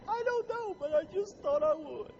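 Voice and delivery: high, put-on teary whimper voice; weepy coworker. Weepy coworker answering why she's crying, high whimpering voice. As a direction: play the coworker who cries for no reason, high and whiny.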